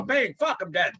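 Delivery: movie quote. Retold or imitated. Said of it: "What was that a reference to?".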